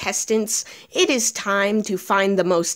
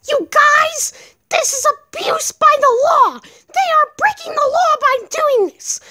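very high squeaky creature voice, urgent; rally the troops in a tiny high-pitched voice; high squeal rising to a battle cry; outraged little mushroom; 4 dB louder than usual. Acted. Little mushroom rallies the others.

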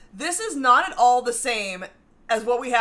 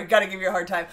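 ordinary talk

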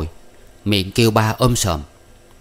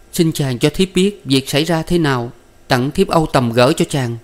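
higher-pitched, mildly pleading woman; weeping plea; 6 semitones higher than usual. A narrator's reading. Wife begs jailed husband to explain, lightly weeping plea. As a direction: plead with a touch of tears. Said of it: "nàng nhào lăn than khóc và nói rằng".

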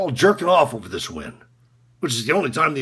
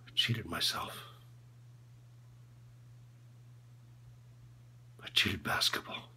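very low, barely voiced near-whisper; drained, whispery self-disgust; ashamed confession; 8 semitones lower, 22 dB quieter than usual. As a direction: confess it in hushed fragments with long pauses. Coach's ashamed confession of cheating.

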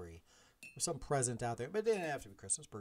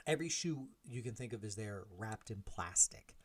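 ordinary talk, nothing marked

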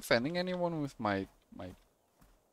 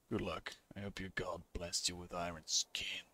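low, quiet gunslinger voice; understated, deep-pitched hero; laconic gunslinger; 7 semitones lower, 10 dB quieter than usual. Acted. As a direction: answer very low and soft. Voices game hero, unmoved.

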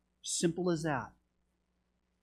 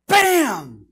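sudden loud, high-pitched shout; explosive bang; 6 semitones higher, 13 dB louder than usual. Retold or imitated. Preacher imitating something hitting the garage, explosive bang. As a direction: burst it out like a rifle shot.

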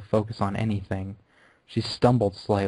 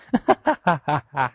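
loud, high villain cackle; manic laugh; 5 semitones higher, 4 dB louder than usual. Acted. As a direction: throw the head back and cackle. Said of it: "laughed manically".

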